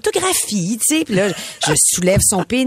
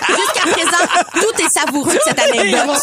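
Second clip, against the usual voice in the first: laughing; 8 semitones higher than usual. Real, not acted. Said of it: "laughing while commenting".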